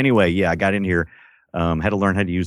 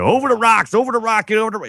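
yelling drill instructor; 13 semitones higher, faster than usual. Retold or imitated. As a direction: play a shouting German drill sergeant, no accent. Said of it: "he's yelling at you".

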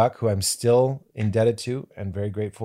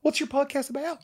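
high, soft, innocent question; a high gentle voice, all sweetness; sweet Jack McBrayer; 5 dB quieter than usual. Retold or imitated. Imitating Jack McBrayer asking about the podcast, high and sweet. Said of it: "The sweet man".

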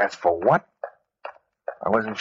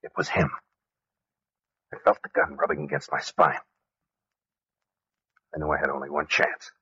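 much softer, lower narration; hushed dread; 9 semitones lower, 8 dB quieter than usual. Acted. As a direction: sink the voice in dread.

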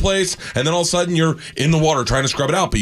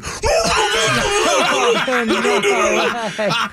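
muffled laugh-cry; 8 semitones higher than usual. Retold or imitated. All you can hear is Croy just going.

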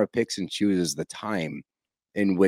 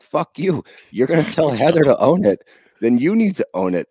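indignant outburst; 6 semitones higher, 5 dB louder than usual. Retold or imitated. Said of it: "It's like".

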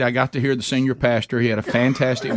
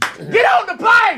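high, slow, loud urging shout; retold shout; 5 dB louder, slower than usual. Gate agent hurrying the late traveler, mock shout. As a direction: belt it out like an agent hurrying him on.

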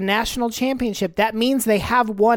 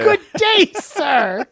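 Willy Wonka dismissal; 4 semitones higher, slower than usual. Pretend. Wonka quote.

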